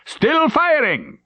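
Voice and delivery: high slow urgent call. Acted. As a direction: call it out over guns. Officer calls out in battle.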